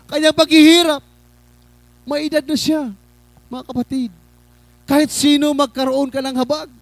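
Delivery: high, projected sermon voice; impassioned preaching. Real, not acted. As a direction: put the challenge to the congregation from the pulpit. Preaches to congregation. (masidhing pangangaral)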